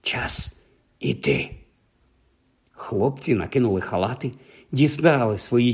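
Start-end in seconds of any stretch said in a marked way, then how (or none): 0.0-1.5 low, breath-only whisper. A narrator's reading. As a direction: whisper it very softly. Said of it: "прошепотів нарешті Рон".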